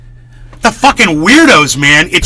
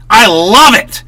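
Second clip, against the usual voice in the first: ordinary delivery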